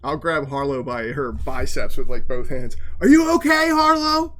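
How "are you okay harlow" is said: deafened, high-pitched yell; ears-ringing shout, pushed high; unnecessarily loud. Acted. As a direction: shout it at a friend right in front of you. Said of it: "speaking un-, unnecessarily loudly".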